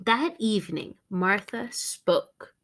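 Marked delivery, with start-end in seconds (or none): none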